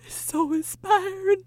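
slow, high, moved near tears; mock tearful awe. Woman imitates nearly crying at a movie, high mock-tearful voice. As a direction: draw it out as if welling up. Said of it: "I almost cried at that".

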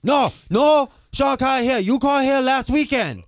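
high, loud mock yell; restaurant worker. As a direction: yell back like the annoyed cook. Worker answering prank call.

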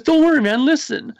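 a hammed-up eager friend pushing him to listen; excited friend. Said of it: "he goes".

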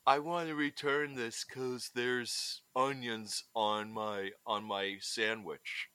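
a lightly voiced Seagal at a fast food counter. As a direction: grumble it slowly like Seagal. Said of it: "he's like".

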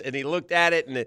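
normal talking voice

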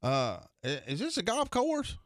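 very high, slow, dumb question; Bobby's put-on innocence. Buddy teasing the secretary, high clueless put-on voice. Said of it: "he was just putting it on for this guy".

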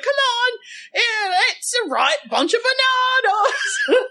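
very high, loud British landlady shriek; British accent. Acted. Sketch landlady rejecting the song, in a shrieking mock British accent. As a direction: holler it in a hammed-up 1940s British accent, near laughter. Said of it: "try to do the accent".

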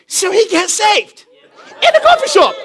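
high, rising celebratory cry; exultant. Real, not acted. Preacher celebrating a coffee shop conversion, exultant and high.

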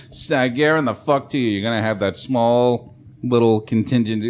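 ordinary talk, nothing marked

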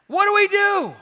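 high, loud pleading call; yelled question. Retold or imitated. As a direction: cry out from the crowd. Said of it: "Somebody yells out in his message".

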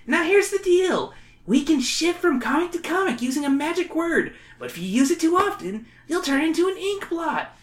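high, whiny schoolkid; whiny kid voice. Acted. Wally character voice.

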